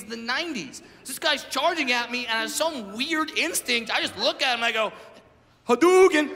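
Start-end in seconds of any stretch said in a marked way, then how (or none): 5.7-6.3 loud, high battle cry; sudden comic attack shout; yelled out. Retold or imitated. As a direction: yell it like a video game special move. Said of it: "And I just yelled it out".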